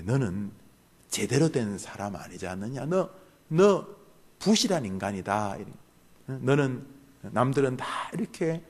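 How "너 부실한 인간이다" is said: usual speaking voice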